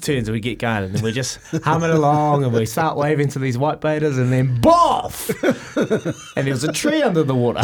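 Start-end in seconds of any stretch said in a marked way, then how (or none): 4.6-5.3 sudden high, loud "boff!" through laughter; loud crash noise. Retold or imitated. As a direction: blurt out the crash sound, laughing. Boat hits underwater tree.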